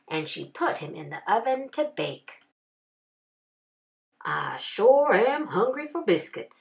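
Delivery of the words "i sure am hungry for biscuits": a ranch hand eager for breakfast; rancher's voice. A narrator's reading. Rancher sniffing the oven, hungry and loud. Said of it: "said the rancher".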